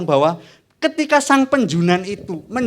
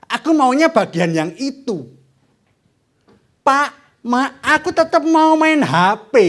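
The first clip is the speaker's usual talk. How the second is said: put-on slow, loud, higher whining kid; whiny child; 4 semitones higher, 5 dB louder, slower than usual. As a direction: demand it like a stubborn child. Child rebelling against parents.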